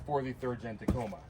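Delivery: breathless after carrying the heavy box; winded, panting between words; out of breath. Real, not acted. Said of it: "Man, I'm out of breath".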